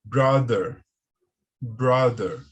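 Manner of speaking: "Brother" said "brader", firm voiced th; teacher models "brother". Shown as a demonstration. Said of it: "parece muito com o som do D".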